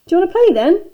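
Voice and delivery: high, loud, eager question. A narrator's reading. Darvesh invites Dennis to a kickabout, eagerly. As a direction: ask hopefully, raring to go. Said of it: "asked Darvesh eagerly".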